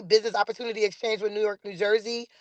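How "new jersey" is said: ordinary talk, nothing marked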